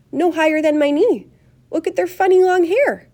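high, loud amazement; thrilled onlooker pointing things out; excited shouting. A narrator's reading. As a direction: exclaim over a marvel. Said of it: "everybody started shouting at once".

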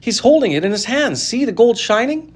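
marveling aloud; excited crowd. A narrator's reading. Crowd shouting.

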